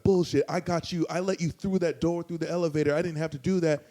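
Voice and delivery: mock indignant insistence; retold angry rebuttal. Imitating Jeff arguing back.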